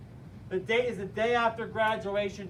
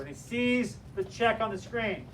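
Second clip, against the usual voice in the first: ordinary delivery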